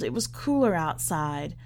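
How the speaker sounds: usual speaking voice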